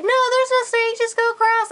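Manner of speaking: very high mock dad voice; high, level-toned parody of a father; mimicked dismissive dad. Acting out dad dismissing her.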